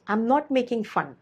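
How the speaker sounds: normal talking voice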